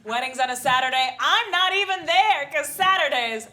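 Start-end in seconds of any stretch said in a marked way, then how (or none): 1.2-3.5 slow and loud, high with mock outrage; loud slow punchline. Comic punchline, boys weekend.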